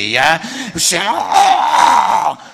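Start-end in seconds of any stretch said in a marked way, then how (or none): 1.0-2.4 loud, hammed-up wail of agony; cry for mercy. Strangled steering wheel begging the wife for mercy, loud mock wail. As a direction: howl like you're being strangled. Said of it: "crying out for mercy".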